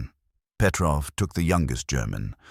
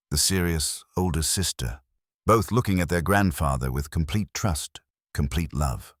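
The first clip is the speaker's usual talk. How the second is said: usual speaking voice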